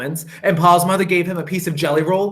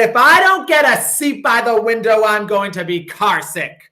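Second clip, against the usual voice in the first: put-on high, louder whining kid; whiny child; 6 semitones higher, 4 dB louder than usual. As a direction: whine like a little boy demanding the window. Child whining for window seat.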